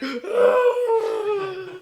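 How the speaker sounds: loud, very high imitation of a crying mom; mock crying wail. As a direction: wail long and loud like a mother in tears. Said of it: "my mom was crying".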